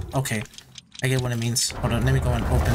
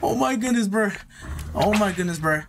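giddy relief; 8 semitones higher than usual. Real, not acted. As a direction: cheer like you barely survived. Finally clears the level.

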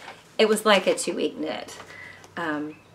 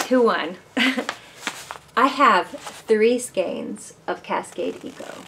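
ordinary delivery

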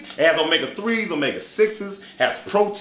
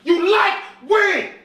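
loud, high exasperated yell; exasperated shout; 5 dB louder, slower than usual. Acted. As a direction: shout him down. Shouting at friend Justin.

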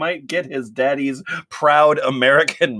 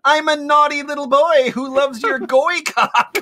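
mock whine; 11 semitones higher than usual. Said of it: "arching his hips".

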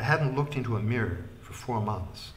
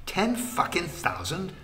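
higher, stretched outraged disbelief; exploding; 8 semitones higher, 3 dB louder, slower than usual. A narrator's reading. Wickham outraged at the official's price, exploding. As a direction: blurt it out, stunned and furious. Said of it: "Wickham exploded".

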